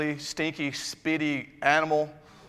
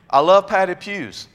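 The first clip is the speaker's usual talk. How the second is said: LOVE hit hard, higher and louder, slowly; loud slow declaration; 4 semitones higher, 7 dB louder, slower than usual. Shown as a demonstration. Preacher repeats his confession to the congregation, loud and slow. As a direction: belt out I love padded pews, slow and loud, leaning on LOVE. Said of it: "I am the first person to go".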